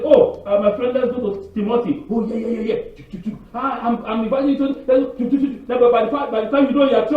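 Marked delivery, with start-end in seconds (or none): 0.0-5.7 friend inviting. Retold or imitated. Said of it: "someone say".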